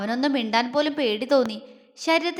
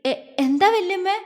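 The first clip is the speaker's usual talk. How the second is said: higher, stammering worried ask; anxious question; 6 semitones higher than usual. A narrator's reading. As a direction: ask with a racing heart. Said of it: "ഏറെ നെഞ്ചിടിപ്പോടെ അവൾ അവരോട് ചോദിച്ചു". (പേടിയോടെ ചോദ്യം)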